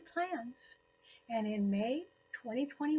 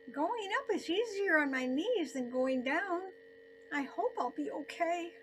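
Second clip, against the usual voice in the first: higher, a little louder, anxious inner voice; worried thought; 6 semitones higher, 3 dB louder than usual. Retold or imitated. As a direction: voice the nagging worry about her knees. Worried inner thought while hiking.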